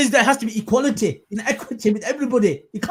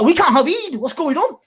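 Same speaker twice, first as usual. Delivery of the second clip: higher, incredulous repeat of can't; mock disbelief; 10 semitones higher than usual. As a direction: throw up your hands at can't have Eid.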